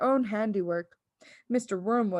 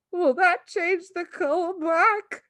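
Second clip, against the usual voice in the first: anxious; 9 semitones higher, 5 dB louder, slower than usual. A narrator's reading. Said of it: "the father asked anxiously".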